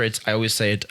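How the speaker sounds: normal talking voice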